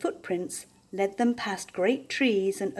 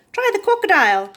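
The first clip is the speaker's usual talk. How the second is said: higher, louder, fast bird squawk; 11 semitones higher, 9 dB louder, faster than usual. A narrator's reading. Another bird squawks a suggestion, fast and squawky. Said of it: "squawked another".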